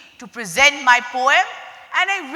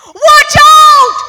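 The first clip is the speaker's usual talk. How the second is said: much higher, much louder shriek; 14 semitones higher, 9 dB louder than usual. Acted. Panicked dentist shrieks a warning while climbing the wall. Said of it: "the dentist shrieked".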